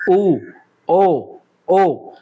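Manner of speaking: ऊ and ओ said with rounded lips, ओ repeated; rounded vowel demo. Shown as a demonstration. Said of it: "जैसे बोलोगे ऊ". (होंठ गोल करके ऊ, ओ, ओ बोलकर दिखाओ)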